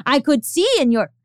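lightly imitating an old Austrian neighbour; Austrian accent. Imitating the neighbour who ratted her out, light Austrian accent. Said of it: "in her Austrian accent".

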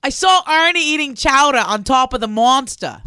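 loud Boston accent attempt. Shown as a demonstration. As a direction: lean into the Boston vowels. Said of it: "you want me to do it".

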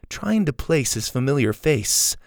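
usual speaking voice

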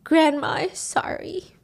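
high, slow, weakening voice; dying words. Retold or imitated. Dying grandma apologizing to Mimo, slow and frail. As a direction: draw it out feebly.

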